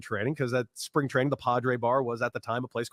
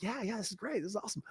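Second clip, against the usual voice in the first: imitating Kotsay, much softer and higher; hushed excited aside; whispered glee; 6 semitones higher, 14 dB quieter, faster than usual. Coach grinning in the office, softly eager. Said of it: "He's like".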